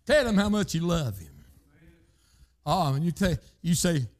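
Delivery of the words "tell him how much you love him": ordinary delivery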